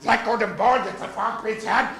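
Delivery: bawdy nursery tale in mock German; put-on German-accented storyteller. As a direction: tell it with a hammed-up German accent. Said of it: "Tell the real story of Mother Goose in this accent".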